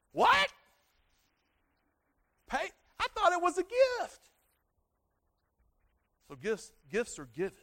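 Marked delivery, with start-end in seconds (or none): 0.1-4.3 much higher, louder, indignant disbelief; shocked outrage. Retold or imitated. Kid told to pay for his gift, shocked and much higher. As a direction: squawk it like a cheated kid.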